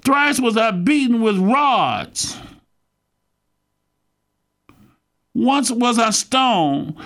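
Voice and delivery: high, slow, measured scripture declamation; scripture reading. Real, not acted. Preacher reads Paul's sufferings to his congregation, scripture reading. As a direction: read it out slowly, pitched well up.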